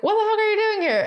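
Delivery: high, quick mock disbelief; imitating baffled driver. Oscar to George at the stand, high mock astonishment. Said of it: "Oscar is like".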